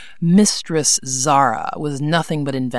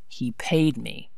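slow, softer, sullen mumble; grudging, quiet and slow; 4 semitones lower, 8 dB quieter, slower than usual. A narrator's reading. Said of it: "Rodney said sullenly".

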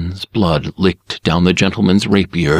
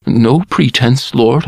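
higher, meek servant voice; humble servant, higher; 6 semitones higher, 3 dB louder than usual. A narrator's reading. Barber answers the lord, meek and higher. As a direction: offer it meekly upward. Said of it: "I mumbled".

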